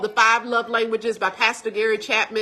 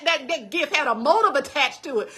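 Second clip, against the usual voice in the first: normal talking voice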